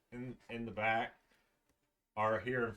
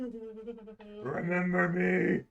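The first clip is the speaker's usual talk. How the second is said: golem voice; 6 semitones higher, 3 dB louder, much slower than usual. Acted. Golem greets presumed-dead friend.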